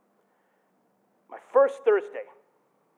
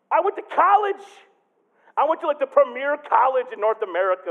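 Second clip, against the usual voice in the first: mock self-important; 8 semitones higher, 7 dB louder than usual. Mocking his younger proud self.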